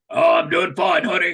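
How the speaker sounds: imitating a deep Rhode Island woman's accent; loud mock Rhode Island greeting. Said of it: "They have these really deep accents".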